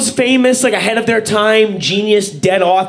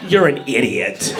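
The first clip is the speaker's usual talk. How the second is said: lower, mocking put-down; scornful mob; 9 semitones lower, 4 dB quieter than usual. Crowd mocking Copernicus, low scornful jeer. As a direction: sneer at him like the crowd. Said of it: "And everyone was like".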